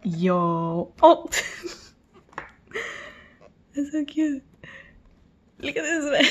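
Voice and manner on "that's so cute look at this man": high, delighted fangirling; adoring gush. Real, not acted. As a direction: coo in high-pitched delight. Fan gushing over a picture.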